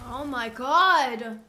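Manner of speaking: loud drawn-out gasp of shock; shocked shout. Real, not acted. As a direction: shout it out in disbelief. Shocked by powers in game.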